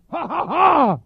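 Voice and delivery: loud, high ha-ha-ha; mimicked pulpit outburst at full volume; imitated preaching "ha". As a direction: punch out the preacher's ha, last one biggest. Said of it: "all he's doing is saying".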